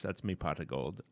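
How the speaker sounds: put-on leprechaun-style Irish accent, softly; jokey Irish accent, dropped low. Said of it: "no, that's Irish".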